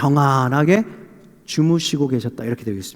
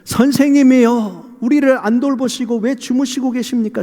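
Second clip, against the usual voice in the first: disciples' reproachful appeal; 5 semitones higher than usual. Acted. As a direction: plead with the teacher, aggrieved. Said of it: "관계를 느낄 수 있는 그런 뉘앙스로".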